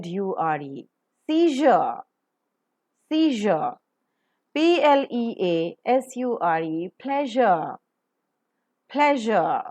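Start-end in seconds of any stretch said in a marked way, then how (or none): 1.3-3.7 "seizure" as see-zhuh, each time on its own; practice model pronunciation. Shown as a demonstration. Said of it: "Let's practice". 7.0-9.6 "pleasure" with breath after p and careful zh; aspirated model pronunciation. Shown as a demonstration. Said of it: "Aspirate per, pronounce je properly".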